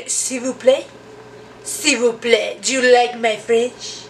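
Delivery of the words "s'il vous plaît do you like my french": slow, hammed-up French accent; playful mock-French accent; terrible French accent. Shown as a demonstration. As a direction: put on a bad French accent, drawn out. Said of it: "that was a terrible French accent".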